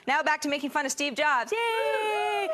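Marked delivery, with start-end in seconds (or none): 1.5-2.5 mock cheer. Mock cheer after news pivot.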